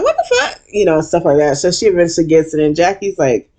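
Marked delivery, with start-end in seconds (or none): 0.0-0.6 high, laughing shock; laughing disbelief. Retold or imitated. Narrator reacting to Heavenly, high laughing shock. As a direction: squeak out the disbelief. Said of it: "I'm like".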